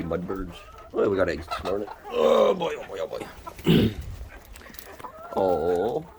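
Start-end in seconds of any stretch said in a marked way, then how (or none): none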